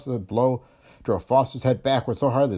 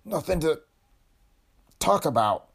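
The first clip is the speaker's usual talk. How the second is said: gasping; 8 semitones higher than usual. A narrator's reading. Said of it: "Foster was gasping a little".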